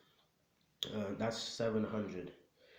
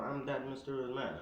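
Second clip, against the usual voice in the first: ordinary delivery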